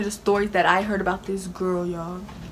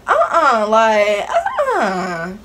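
refusal; 6 dB louder, much slower than usual. Retold or imitated. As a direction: shut it down, sassy. Refusing jury's hypocrisy.

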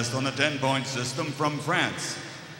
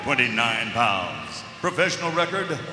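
fast stats then booming name; lucha libre announcer; 4 semitones higher, 5 dB louder than usual. Acted. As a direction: race the numbers, then build to a roar. Fighter introduction.

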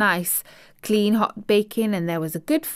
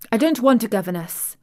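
sharp retort; 4 semitones higher, 4 dB louder, faster than usual. Acted. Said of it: "said Mary sharply".

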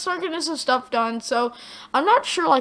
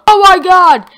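startled shriek, much louder and higher; jump scared; 5 semitones higher, 15 dB louder than usual. Real, not acted. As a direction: yelp like something leapt out. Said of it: "that flippin' scared me".

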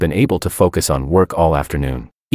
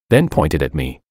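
usual speaking voice

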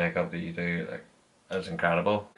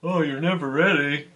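high whiny mimic of her nagging; mocking girlfriend; 7 semitones higher, 3 dB louder than usual. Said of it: "she'll start shouting at me".

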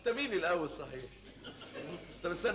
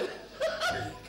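high breathless cackle; 3 dB quieter than usual. Acted. Laughs at father's death.

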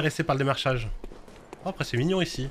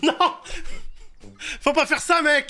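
high laughing protest; laughing yell. Real, not acted.

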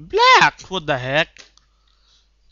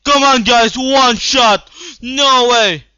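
loud excited cheering; hyped shouting; 5 semitones higher, 10 dB louder, slower than usual. Real, not acted. Gamer urging teammates in final fight, loud cheering. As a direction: cheer your team on at full volume.

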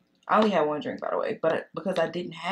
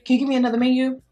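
raised, higher mimicked calling; 5 semitones higher, 5 dB louder, much faster than usual. Storyteller imitating her date calling the bartender, insistent. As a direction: imitate a customer pestering for attention. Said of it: "he kept calling the guy".

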